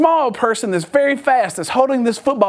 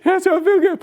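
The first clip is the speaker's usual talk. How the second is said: put-on high-pitched boy's answer; tense kid voice; 9 semitones higher than usual. Son at the wheel answering dad, high and tense. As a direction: imitate a boy under strain, pitched up.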